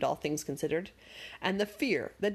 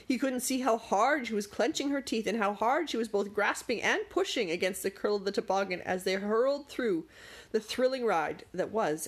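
usual speaking voice

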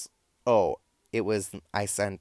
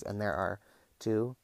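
ordinary talk

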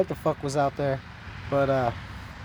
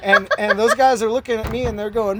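usual speaking voice

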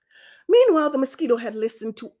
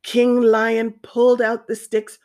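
ordinary delivery, nothing marked

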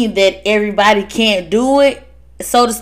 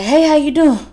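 mock worried greeting; 6 semitones higher than usual. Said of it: "and it's like".

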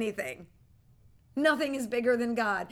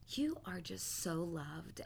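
tender; 4 semitones lower, 9 dB quieter, slower than usual. Retold or imitated. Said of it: "and I said".

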